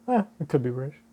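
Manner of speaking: plain reading voice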